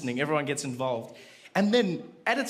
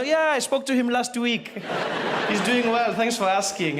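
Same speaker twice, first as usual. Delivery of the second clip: casual chitchat; 6 semitones higher, 4 dB louder than usual. Retold or imitated. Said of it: "You know".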